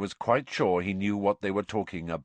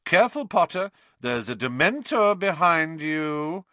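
mock warning; 7 semitones higher, 5 dB louder than usual. A narrator's reading. Malfoy baiting Harry, mock warning. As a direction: taunt with feigned alarm.

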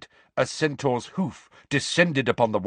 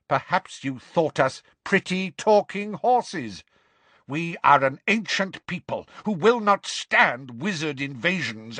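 proud, raised-pitch tirade; indignant shouting; 6 semitones higher than usual. Acted. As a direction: declaim with offended pride, building until cut off. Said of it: "shouted the grey centaur".

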